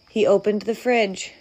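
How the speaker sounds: plain reading voice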